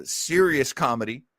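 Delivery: ordinary delivery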